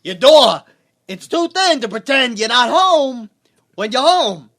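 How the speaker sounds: loud, slow impression with odd breaks; comedian's Walken impersonation, big and drawn out; Christopher Walken. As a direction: belt it out in halting, high-pitched chunks. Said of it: "a flawless Jay Moore doing Christopher Walken".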